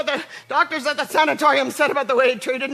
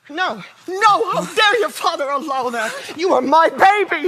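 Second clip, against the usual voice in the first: distressed protest; 4 semitones higher, 6 dB louder than usual. Acted. Mother protesting son's sanatorium.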